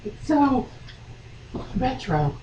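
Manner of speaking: mock heroine voice. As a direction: play the daughter, light and silly.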